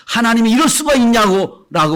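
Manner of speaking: high, loud indignant outburst; angry protest. Retold or imitated. Preacher mimics an angry believer, loud and indignant. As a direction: rail at God in outrage. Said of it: "화나가지고".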